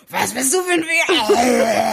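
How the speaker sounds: half-laughing bark at the man; mock monster voice. On the train, a laughing mock monster roar. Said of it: "mit meiner Monsterstimme".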